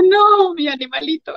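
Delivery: high cry of dismay for a pet. Retold or imitated. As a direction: wail it like losing a pet. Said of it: "pues dije". (grito agudo de consternación)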